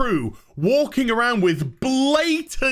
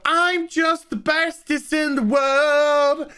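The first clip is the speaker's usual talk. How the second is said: mocking Mikey; 4 semitones higher than usual. Ranter mocking Mikey Burnham's self-importance, smug mock voice. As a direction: sneer it out like a narcissist bragging. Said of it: "Mikey had to go".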